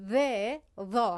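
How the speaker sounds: "ذ" light, then "ذا" heavy; voiced th in "ذ", darkened in "ذا"; tongue-tip voiced th. Shown as a demonstration. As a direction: buzz the tongue tip at the front teeth for "ذ، ذا".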